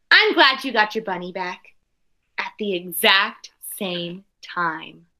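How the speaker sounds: put-on expressive read-aloud for children; storybook reading voice; story narration. A narrator's reading. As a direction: read the picture book to the class with care. Teacher reads picture book aloud.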